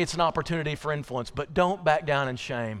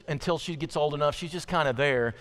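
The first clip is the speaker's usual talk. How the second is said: normal talking voice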